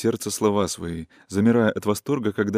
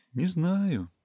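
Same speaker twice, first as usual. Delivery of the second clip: «Не знаю» higher and softer, falling away thoughtfully; pensive; 8 semitones higher, 4 dB quieter than usual. A narrator's reading. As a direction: muse over «Не знаю» as if to herself. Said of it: "сказала задумчиво Катерина".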